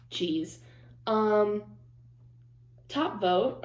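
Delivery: usual speaking voice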